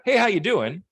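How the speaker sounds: breezy high, loud passing hello; offhand hallway greeting; casual greeting. Retold or imitated. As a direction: toss it off like a quick hi. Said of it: "like a greeting".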